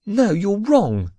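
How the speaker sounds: high, drawn-out quivering protest; distressed, high-pitched denial. A narrator's reading. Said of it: "she quivered".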